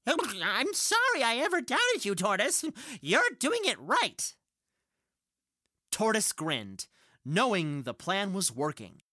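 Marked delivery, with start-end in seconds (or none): 0.1-4.3 shouting. Acted.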